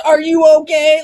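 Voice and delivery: mock worried shout. Imitating worried commenters on an emotional post, loud mock alarm. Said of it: "hundreds of comments".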